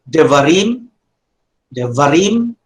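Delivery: devarim with "rim" drawn up in a rising tone; stress on final syllable. Shown as a demonstration. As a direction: lift and lean on the last syllable of devarim, "rim". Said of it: "that's the tone".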